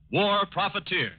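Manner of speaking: ordinary delivery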